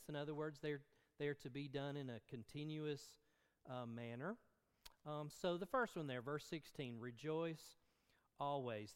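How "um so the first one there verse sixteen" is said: ordinary talk, nothing marked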